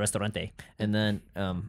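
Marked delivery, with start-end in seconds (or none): 0.0-0.5 lightly put-on Spanish pronunciation; Spanish-flavored word dropped in offhand; mock Spanish pronunciation. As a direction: throw in a casual Spanish-style ending. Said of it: "Why you say it like that".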